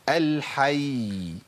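"الحي" very slow, final ya held on; lengthened final ya. Shown as a demonstration. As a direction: stop on "الحي" and let the ya linger. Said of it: "لازم أطول الزمن شوية".

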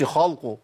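خلقه with a heavy, thickened lam; wrong heavy lam. Shown as a demonstration. Teacher mocks the wrong pronunciation. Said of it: "ما قلتش".

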